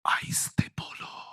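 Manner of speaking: unvoiced whispered shush; whispered hush. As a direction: hush him like a churchgoer. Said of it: "कुछ गलत बोला क्या?". (फुसफुसाकर चुप कराना)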